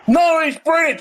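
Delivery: high, flat-out insistent no; mock-indignant guest insisting; stubborn denial. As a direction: toss it back as a sure correction.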